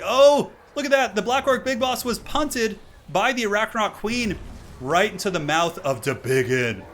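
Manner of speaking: thrilled shout. Real, not acted. Caster watching the boss punted into a mouth, thrilled. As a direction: belt out the highlight in disbelief.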